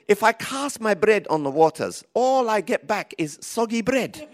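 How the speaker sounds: a hint of the confused Japanese lady; quoting the Japanese lady. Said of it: "I can't do the Japanese accent".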